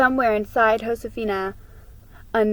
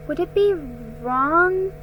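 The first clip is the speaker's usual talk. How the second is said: put-on higher girl's voice, worried and tentative; a young girl asking anxiously; young Josefina; 10 semitones higher than usual. A narrator's reading.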